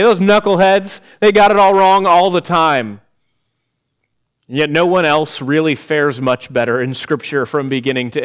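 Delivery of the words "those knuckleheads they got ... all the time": raised, louder scoffing at the knuckleheads; mocking scorn. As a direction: sneer at them from above. Mocking judgment of Israel.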